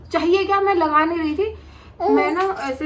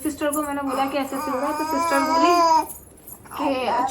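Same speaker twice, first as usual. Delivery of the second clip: ordinary talk, nothing marked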